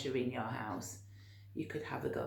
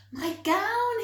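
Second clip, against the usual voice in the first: high, loud happy shout; joyful yell; 7 dB louder than usual. A narrator's reading. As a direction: shout it out with delight. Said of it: "he yelled".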